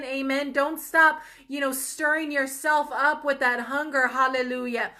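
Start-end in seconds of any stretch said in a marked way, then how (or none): 0.5-1.2 a louder preacher's push on don't stop; urging call. Real, not acted. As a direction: press the listener on, punch it out. Preacher exhorting the viewer.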